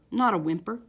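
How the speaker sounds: plain reading voice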